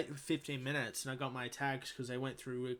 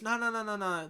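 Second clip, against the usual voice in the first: quick high-pitched no no no, louder; excited insistent bloke; 8 semitones higher, 4 dB louder than usual. Retold or imitated. Station worker insisting to the narrator, imitated high and louder. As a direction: mimic the eager station worker cutting him off.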